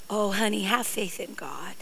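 soft, whispered, gentle mock comfort; hushed pampering whisper; mock tender. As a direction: coo it like soothing a child. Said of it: "not trying to say".

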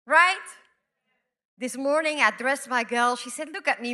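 ordinary talk, nothing marked

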